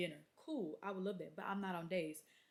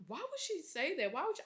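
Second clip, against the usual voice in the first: higher pitched disbelief; high surprised question; 9 semitones higher than usual. Retold or imitated. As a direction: ask in shocked surprise. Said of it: "she was like".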